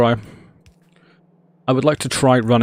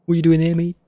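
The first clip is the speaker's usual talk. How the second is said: higher, surprised meme quote; higher put-on mock surprise; 6 semitones higher, much faster than usual. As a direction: ask it in a put-on cartoon voice. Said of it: "random knowledge of random meme".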